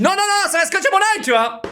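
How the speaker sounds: sudden high panicky no no no; panicked inner voice. Retold or imitated. Patient panicking as the doctor tapes his eye, high. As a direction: jump way up in pitch, alarmed.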